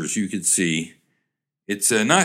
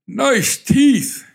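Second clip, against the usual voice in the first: put-on high, loud comic old-man voice; 12 semitones higher, 6 dB louder than usual. Toothless old man at a dance show, comic old-man voice. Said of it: "the old man says".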